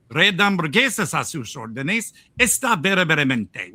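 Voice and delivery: put-on Spanish accent; Spanish drive-thru greeting. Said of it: "the best I can do is some Spanish".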